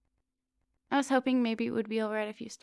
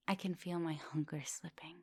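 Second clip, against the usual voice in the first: hushed, softer whisper; 7 dB quieter than usual. Acted. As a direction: confess it under your breath. Vampire admits losing control.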